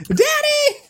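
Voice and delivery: high wailing call for daddy; hammed-up tearful shout of Daddy; crying child. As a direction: wail it like a scared kid. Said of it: "And then a lot of crying".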